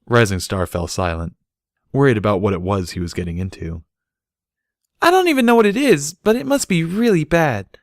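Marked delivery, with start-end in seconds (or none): 5.0-7.8 put-on high, loud childish voice; little filly. A narrator's reading. Little filly wondering at the chaos. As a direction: say it wide-eyed like a kid.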